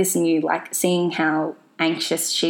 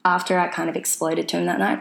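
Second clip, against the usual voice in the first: normal talking voice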